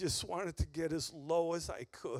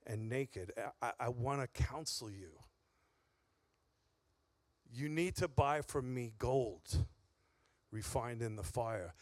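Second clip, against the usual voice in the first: tender rebuke; 5 semitones lower, 7 dB quieter than usual. Retold or imitated. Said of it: "Jesus ... said".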